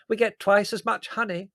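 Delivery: high, loud boasting aunt; proud. A narrator's reading. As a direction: carry on boasting obliviously. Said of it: "said Aunt Fan proudly".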